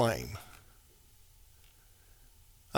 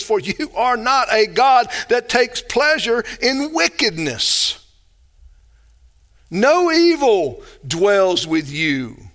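forceful, slowing scripture declaration; emphatic; 5 semitones higher, slower than usual. Retold or imitated. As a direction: declare it emphatically, drawing out the final words. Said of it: "That's emphatic. This is emphatic".

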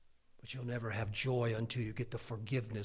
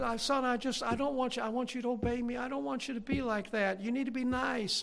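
high, earnest imitation of a lecturing mother; mom's little sermon; 10 semitones higher, 5 dB louder than usual. Mom advising her son, high tearful lecture. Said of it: "she'd give me a little sermon".

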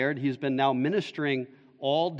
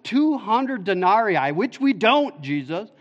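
climbing higher and louder, slowing on "two hundred denarii"; rising exasperation; 6 semitones higher, 5 dB louder than usual. Retold or imitated. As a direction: build the protest to its peak. Disciples voiced protesting the cost.

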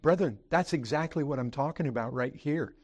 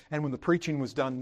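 ordinary delivery

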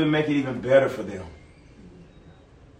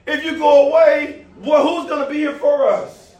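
a touch of upset protest, raised and louder; mock-angry disciples; mad disciples; 9 semitones higher, 4 dB louder than usual. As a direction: plead against Jesus leaving. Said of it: "They're mad".

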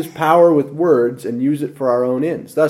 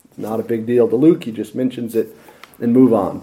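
usual speaking voice

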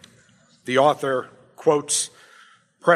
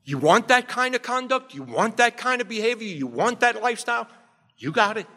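a lightly raised, mocking God handing people over; God giving them what they want, pitched up; 6 semitones higher than usual. Preacher voicing God granting the sinner's wish, mock-challenging. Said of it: "there comes a time when God says".

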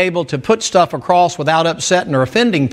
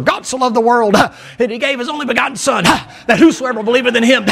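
high, hurried chant with gasped breaths after each phrase; hacking preacher; 7 semitones higher, faster than usual. Retold or imitated. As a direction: imitate an old-time preacher hacking out a breath at every phrase end. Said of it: "They call that hacking".

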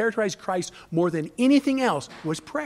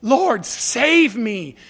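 slow, loud plea for rescue; drawn-out acted cry to be saved; 5 dB louder, much slower than usual. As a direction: call out to the Lord as if sinking. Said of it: "he cried out to the Lord".

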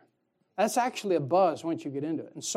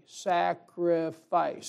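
"Sacrifice" stretched out slowly, syllable by syllable; said very slowly together; much slower than usual. Shown as a demonstration. Preacher leading the church to say the word with him, very slowly. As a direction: draw out each syllable of "Sacrifice". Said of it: "Say it with me".

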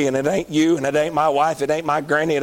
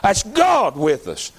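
ordinary talk

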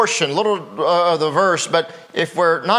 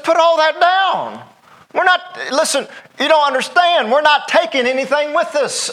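impatient angel; 9 semitones higher than usual. Retold or imitated. Angel scolds lingering Lot to drop everything, exasperated. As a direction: stop dawdling, sternly impatient. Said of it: "the angel just loses patience with him".

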